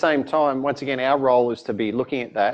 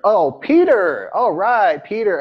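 raised, loud, drawn-out American "Peter"; American barista; 5 semitones higher, 5 dB louder, slower than usual. Retold or imitated. Barista finally getting the name, in mock American recognition. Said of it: "I have exactly the same problem".

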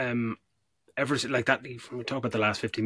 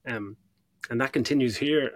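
usual speaking voice

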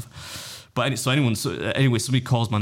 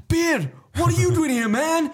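much higher, delighted shout of recognition; excited recognition; slower than usual. Retold or imitated. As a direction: greet him like a thrilled fan who spots a YouTuber. Fan recognises him at the door.

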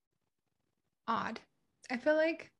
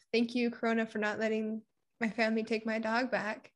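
ordinary talk

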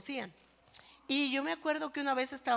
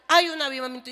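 Hay un avivamiento shouted much louder; loud preacher's cry; shouted declaration; 3 semitones higher, 12 dB louder, faster than usual. Acted. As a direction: proclaim it to the rafters. Rising revival declaration.

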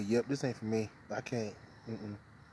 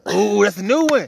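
excited; 8 semitones higher, 18 dB louder than usual. Acted. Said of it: "He adds every single fucking time".

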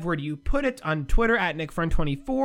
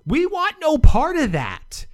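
slow, louder, higher mock statement; mock official statement; 8 semitones higher, 6 dB louder than usual. To the NCAA, a slow mock official refusal. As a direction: read it out like a stiff press release. Quoting USA Basketball's statement.

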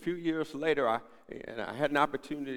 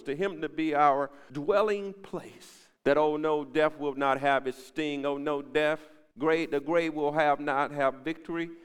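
louder, higher, rising preacher's cry; full force preaching; 4 semitones higher, 8 dB louder than usual. Acted. Pastor proclaiming victory over death to the church, full preaching cry. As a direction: build it up like a revival pulpit.

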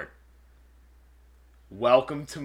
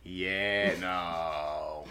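low, soft, drawn-out brush-off; smiling refusal; 6 semitones lower, 6 dB quieter than usual. Acted. Co-host imagining turning someone down, low smiling refusal. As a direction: smile and sink lower on each word. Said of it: "looked at you and smiled and like".

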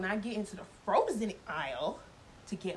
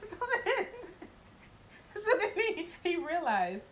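high-pitched laughing through the words; 9 semitones higher than usual. Real, not acted. Telling what he realized, laughing. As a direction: struggle to continue through real giggles. Cracks up at her story.

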